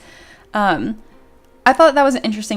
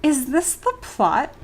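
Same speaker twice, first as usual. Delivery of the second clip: exasperated; 6 semitones higher, slower than usual. Retold or imitated. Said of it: "I'm just sitting there like".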